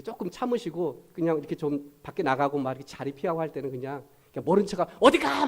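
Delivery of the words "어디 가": a single mock shout, higher and loud; just yell once for show; token shout. Shown as a demonstration. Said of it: "소리만 한번 지르시고". (한 번만 소리치는 시늉)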